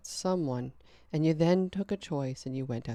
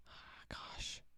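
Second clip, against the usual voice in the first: unvoiced frustrated whisper; 19 dB quieter than usual. Real, not acted. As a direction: sigh it out under breath.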